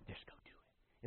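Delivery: whispered coaxing like an angel on her shoulder; whispered urging. Retold or imitated. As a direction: breathe it in her ear. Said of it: "trying to be this little angel on her".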